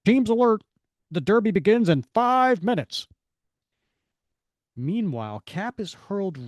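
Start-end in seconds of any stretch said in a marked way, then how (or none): 0.0-3.1 loud, high announcement; urgent, projected alert; derby announcer. A narrator's reading. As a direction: call the teams to attention.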